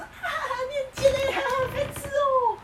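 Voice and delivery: very high, slow, sing-song tease; cutesy teasing. Shown as a demonstration. Friend showing how to tease sweetly, high sing-song. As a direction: tease sweetly so it lands as a joke. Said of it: "你如果说".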